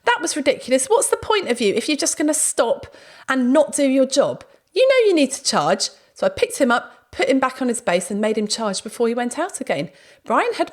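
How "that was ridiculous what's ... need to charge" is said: exasperated telling-off. Retold or imitated. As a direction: tell off the robot in high exasperation. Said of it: "No, I was like".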